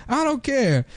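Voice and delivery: high frantic don't-care voice; crazed soldier. Retold or imitated. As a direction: shout it like a wild soldier. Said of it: "he's like".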